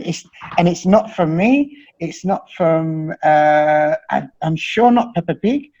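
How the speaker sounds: normal talking voice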